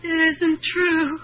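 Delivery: slow, tearful denial, repeated; weeping denial that trails off; distraught sobbing after Peter walks out; breaking down. Acted. Ethel breaks down after Peter leaves.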